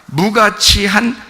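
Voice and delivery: 무가치한 drawn out slowly with a rise; slowed for emphasis. Shown as a demonstration. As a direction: stretch out 무가치한 slowly, rising at the end. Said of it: "자". (무가치한을 천천히 끌며 올려 강조)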